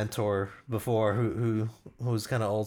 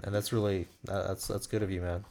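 ordinary talk

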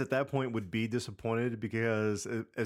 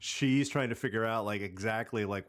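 ordinary talk, nothing marked

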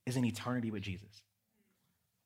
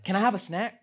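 imitating his son; 8 semitones higher, 8 dB louder than usual. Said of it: "he's like".

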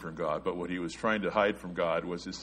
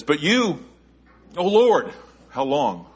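much higher, louder cry of despair; anguished cry; 8 semitones higher, 10 dB louder, slower than usual. Retold or imitated. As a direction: cry it out to the Lord. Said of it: "He says".

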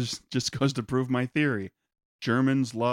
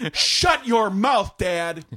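put-on indignant kid; 8 semitones higher, 7 dB louder, much slower than usual. Kid snaps at dad.

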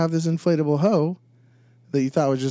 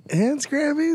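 high mock surprise; 13 semitones higher, slower than usual. Real, not acted. Mock astonishment.

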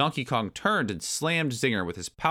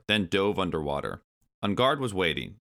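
plain reading voice

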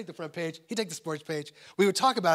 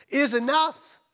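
"enough" pushed out high and loud; stress on ENOUGH; 10 semitones higher, 13 dB louder, much slower than usual. Real, not acted. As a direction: land hard on the word "enough". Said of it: "I wanna emphasize the word enough".

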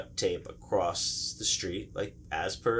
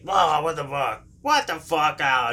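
angry mock yell; 6 semitones higher, 5 dB louder than usual. Driver stops over a false flat tire, angry yell. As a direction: play the baffled, furious driver.

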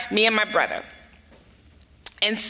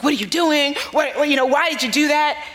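higher-pitched retold mom demanding answers; mildly exasperated, voice pitched up; worried questioning; 4 semitones higher than usual. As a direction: question him like a flustered mom. Worried mom confronts father afterwards.

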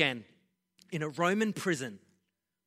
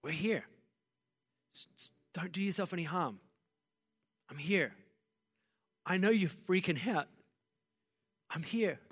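calm someone who is panicking; reassuring. Acted. Reassuring voice from the jailer scene.

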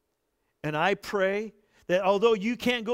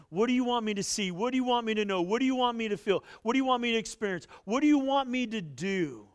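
model prayer; 6 semitones higher, faster than usual. Acted. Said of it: "Say, "Father".

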